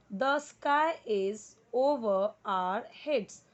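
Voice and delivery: very slow, word by word; slow model reading. Shown as a demonstration. Teacher reads the sentence slowly for students to copy. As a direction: read each word out for students to repeat. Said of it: "जैसे जैसे मैं जिस word को पढ़ूँ आपको उसी तरीके".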